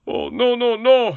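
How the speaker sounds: high, loud begging cries; supplicating. A narrator's reading. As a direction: beg for mercy. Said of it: "in the most supplicating of tones".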